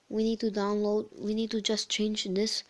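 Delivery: ordinary talk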